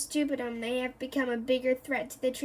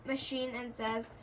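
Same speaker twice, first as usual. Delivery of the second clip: ordinary delivery, nothing marked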